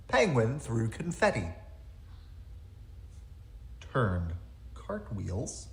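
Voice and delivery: usual speaking voice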